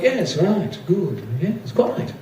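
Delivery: put-on very posh, proper English accent; clipped little pleasantries in received pronunciation; Uncle Dave. Imitating an uncle greeting someone, very posh English. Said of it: "very posh, proper, received pronunciation".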